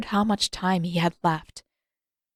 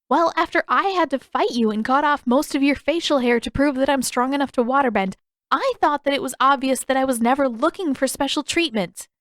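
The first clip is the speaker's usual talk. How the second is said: raised, rushed angry retort; rushed in rage; 6 semitones higher, 3 dB louder than usual. A narrator's reading. Katara firing back at Pakku in a rush of rage. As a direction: let the rage push the words out. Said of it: "The rage buzzing ... the words out".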